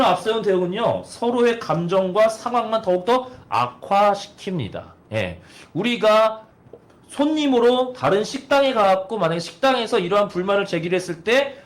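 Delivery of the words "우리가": ordinary talk, nothing marked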